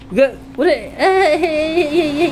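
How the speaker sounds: loud high-pitched repeated yelps; panicked yelping. Real, not acted. Player getting hit in the game, yelping in panic. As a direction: cry out in rapid, rising alarm.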